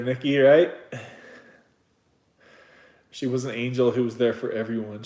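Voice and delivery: ordinary talk, nothing marked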